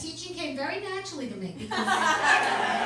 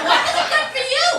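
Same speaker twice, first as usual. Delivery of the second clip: incredulous jab; 12 semitones higher, 13 dB louder, faster than usual. Retold or imitated.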